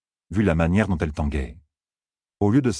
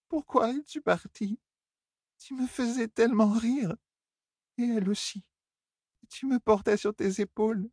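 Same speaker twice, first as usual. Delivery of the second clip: put-on high, softer, childlike sorrow; sad drunk Elaine; 5 dB quieter than usual. Elaine asking Tom why he left, high and softer. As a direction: remember him like a little girl.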